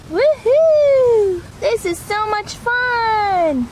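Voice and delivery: high, gleeful puppy squealing at the mud; a child pup thrilled to find dirt, high and loud; excited puppy voice. Acted. Pup character plays in dirt.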